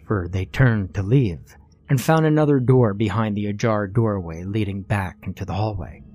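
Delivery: usual speaking voice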